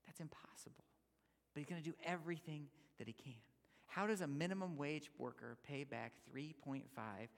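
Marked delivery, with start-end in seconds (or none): 0.1-3.3 whisper. Real, not acted. Aside after the servant's plea.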